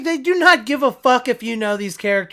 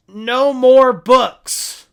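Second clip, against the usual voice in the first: slow emphatic line; 3 semitones higher, 10 dB louder, much slower than usual. Retold or imitated. Quoted angry movie line.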